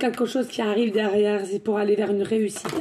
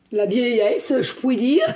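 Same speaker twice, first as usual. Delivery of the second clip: laughing; slower than usual. Real, not acted. Laughing at own tears.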